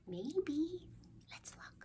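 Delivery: hushed whisper; soft secret whisper. Acted. Hushed suspense before reveal.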